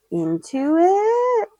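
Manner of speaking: "into it" drawn out slowly, rising high like a question; question intonation. Real, not acted. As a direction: stretch "into it" into a long upward question. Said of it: "like it's a question".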